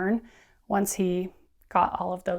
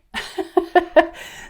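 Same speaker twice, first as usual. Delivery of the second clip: laugh; 10 semitones higher, 6 dB louder than usual. Real, not acted. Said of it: "laughing at length of series".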